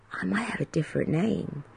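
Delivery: hushed teasing whisper. Real, not acted. Teasing listeners about a new name, whispered. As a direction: lean in and whisper it.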